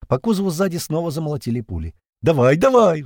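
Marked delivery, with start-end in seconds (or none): 2.2-3.0 loud, eager cheering on; excited shouting. A narrator's reading. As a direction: urge him on with excitement. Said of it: "в азарте кричала девушка".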